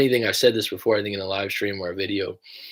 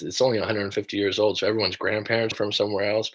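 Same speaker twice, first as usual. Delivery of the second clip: ordinary talk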